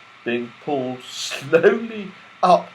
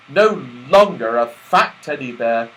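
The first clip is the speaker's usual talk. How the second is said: ordinary delivery, nothing marked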